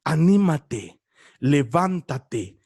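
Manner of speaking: very low, slow solemn call; deep slow divine call. Acted. Preacher voicing the call to the blind man, deep and slow. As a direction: call him up gently from deep down. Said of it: "los discípulos le dijeron".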